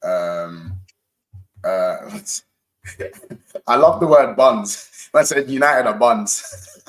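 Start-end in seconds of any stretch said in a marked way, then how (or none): 3.7-6.8 relishing the word buns, amused; amused, laughing. Real, not acted. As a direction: savour the word like a joke.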